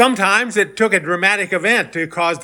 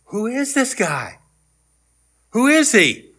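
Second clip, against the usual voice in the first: higher, drawn-out wondering question; amazed question; 5 semitones higher, slower than usual. Acted. Disciples after the calmed storm, amazed. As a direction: ask it as if astonished by him. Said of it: "The men were amazed and asked".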